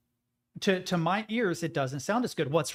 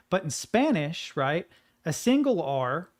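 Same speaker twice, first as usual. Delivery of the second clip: ordinary talk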